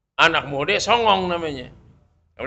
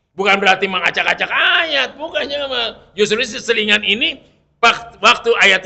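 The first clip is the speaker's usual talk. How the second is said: loud, higher preaching retort; forceful rebuttal; 7 semitones higher, 7 dB louder than usual. Real, not acted. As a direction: hammer the point home at full voice. (membantah dengan suara keras dan tinggi)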